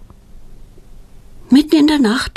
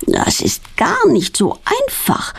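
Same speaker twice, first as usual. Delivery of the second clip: groaning; 8 semitones higher than usual. A narrator's reading. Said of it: "stöhnt sie".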